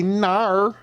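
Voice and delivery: "Nar." said high and drawn out, a Scandinavian-sounding no; teasing imitation of a foreign "Nar" for no; Nordic "nar" for no. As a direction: mimic a Swedish girl's "Nar". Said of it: "N A R is how they say N O".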